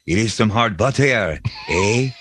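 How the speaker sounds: mock French Canadian accent, stress shifted to the end of "butter"; put-on French Canadian with a tagged "eh". Shown as a demonstration. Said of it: "French Canadian accent would be like".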